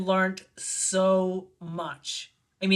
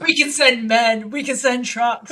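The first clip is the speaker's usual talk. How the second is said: louder, slow and deliberate pronouncement; retold grand offer; 2 semitones higher, 7 dB louder than usual. Repeating the screenwriter's overblown moving offer, slow and loud. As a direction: announce each offer with comic weight. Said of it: "It was hilarious".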